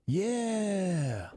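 long, high yelled cheer. A narrator's reading. Santa answering the MC with thousands of others, yelled. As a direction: yell it out with the crowd, drawn out. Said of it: "Santa yelled along".

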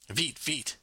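mildly urgent French command; urgent hurrying. A narrator's reading. Mama to papa as customers wait, urgently hurrying. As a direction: hurry them along with two quick-fire words. Mother hurries father in busy kitchen.

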